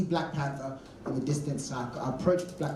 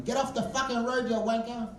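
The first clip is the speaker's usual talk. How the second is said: heckling; 7 semitones higher than usual. Retold or imitated. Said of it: "Everyone's heckling him".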